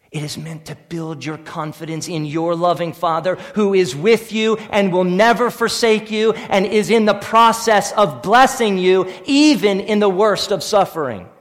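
normal talking voice

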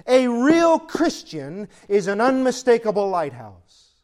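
stack the points and land on lighthouse; mounting sermon cadence; building preacher's list. Acted.

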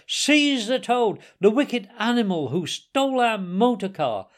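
loud, high, outraged orders; horrified outcry. A narrator's reading. Gentlemen raging at the car thief, crying out in horror. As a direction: cry out each command like a mob. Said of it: "they cried".

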